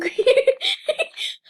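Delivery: shrill nervous laugh after a scare; high startled laugh. Real, not acted. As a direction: laugh out the fright.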